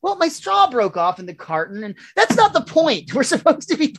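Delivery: cranky, high-pitched outburst; Milhouse. Acted. Milhouse protesting to his partner Bart, cranky and high. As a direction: complain high and worked up. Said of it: "you're getting cranky".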